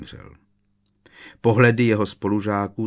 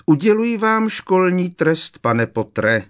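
higher and louder, gloating; triumphant call; 10 semitones higher, 7 dB louder than usual. A narrator's reading. Umbridge gloats as she gives Harry detention. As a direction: announce the punishment in triumph. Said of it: "zvolala triumfálně".

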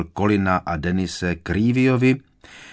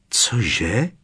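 breathless whispered what; frozen, barely voicing it; whispered shock; 3 semitones higher than usual. A narrator's reading. Harry stunned by Dobby's news, whispering. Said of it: "strnul Harry".

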